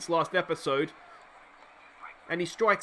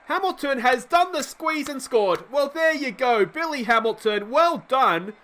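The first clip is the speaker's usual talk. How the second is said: higher, bursting with delight at the score; excited call; 5 semitones higher, 3 dB louder than usual. Real, not acted. Commentator hails Hamilton's surprise score, excited. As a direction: cheer the run home like a fan. Runner scores on squeeze play.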